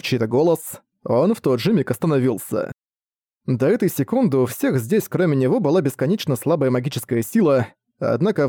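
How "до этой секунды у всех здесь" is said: ordinary delivery, nothing marked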